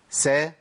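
سا light open a; crisp open sa; sin fatha demo. Shown as a demonstration. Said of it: "تحريك السين بالفتح".

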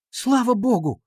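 high-pitched cry of relief; relieved exclamation. A narrator's reading. Катя sees the fist is free, relieved exclamation. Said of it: "воскликнула Катя Леминг".

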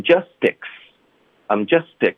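"justice" said as just and ex run together; linked just excited. Shown as a demonstration. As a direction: glide "justice" as one word. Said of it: "所以就变成justice".

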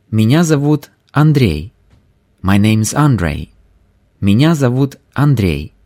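ordinary talk

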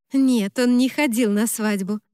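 ordinary delivery, nothing marked

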